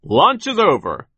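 high, slow, carrying holler; shouted order. A narrator's reading. Foreman ends the workers' lunch break, shouting. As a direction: call time on the break at full voice. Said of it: "he shouted".

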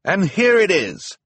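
high, loud, proud call. A narrator's reading. Handyman calling from the control basket, triumphant. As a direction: call it down to those below. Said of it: "called from the control basket".

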